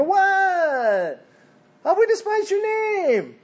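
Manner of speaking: high, put-on voice of baffled, offended priests; indignant priests. Preacher voicing priests protesting to God, high indignant. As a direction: play the priests as wounded innocents. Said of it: "They go".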